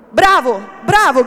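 loud bravos from tavern mates; rowdy shouted cheer. A narrator's reading. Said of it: "gridarono sghignazzando più forte i compagnoni". (acclamazione gridata e chiassosa)

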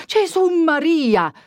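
high, drawn-out cry of fright; terrified exclamation. A narrator's reading. Priest seeing the knife hand, terrified cry. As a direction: gasp out to the saints in terror. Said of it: "sclamò Don Abbondio".

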